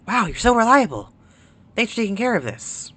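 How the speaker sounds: high acted game-character voice; grateful administrator. Administrator thanking the player for taking the job, acted gratitude.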